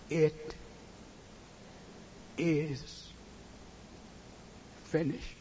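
normal talking voice

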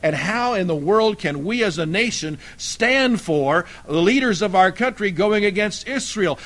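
normal talking voice

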